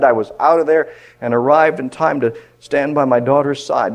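loud, high fervent telling; full-force preaching. Acted. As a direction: preach the story with urgency.

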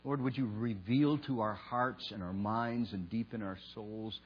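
soft, reverent public prayer. Real, not acted. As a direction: pray quietly, pausing between phrases. Leads a prayer.

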